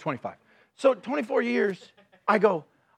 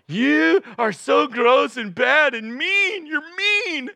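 slow, louder, disgusted verdict; imagined wife scolding; 6 semitones higher, 5 dB louder, slower than usual. Retold or imitated. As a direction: drag out each word like a cutting judgment. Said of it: "where I felt like".

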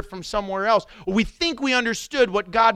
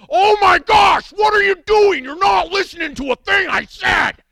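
angry shout; 11 semitones higher, 9 dB louder than usual. Retold or imitated. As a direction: blow up at the drive-thru worker. Frustrated customer yelling at drive-thru.

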